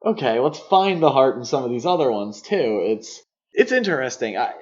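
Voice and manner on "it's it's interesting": ordinary delivery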